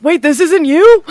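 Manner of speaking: high, loud startled cry; startled shout. Acted. Hero calling down to the goddess, startled and loud. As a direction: yell it as you realise.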